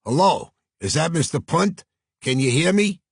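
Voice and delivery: high, loud Brooklyn voice on a long-distance call; Brooklyn accent. Acted. New York agent checking the transatlantic line, strong Brooklyn accent. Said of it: "a voice with a strong Brooklyn accent".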